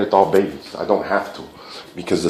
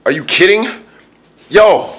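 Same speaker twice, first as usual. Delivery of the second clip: usual speaking voice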